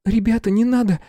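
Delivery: put-on very high, squeaky girl; high-pitched anxious protest; squeaked plea. A narrator's reading. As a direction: squeak a timid plea to stop. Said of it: "пискнула Света".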